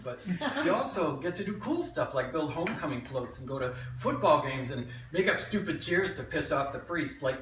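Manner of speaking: lively memoir monologue with swinging pitch; animated childhood tale, present tense; stage storytelling. Acted. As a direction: tell it to a live audience as your younger self. Performs a written memoir monologue.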